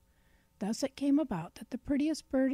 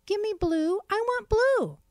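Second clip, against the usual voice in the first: much higher, louder little bird voice; piping bluebird; 11 semitones higher, 6 dB louder than usual. A narrator's reading. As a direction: pipe it up high and eager. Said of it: "piped the bluebird".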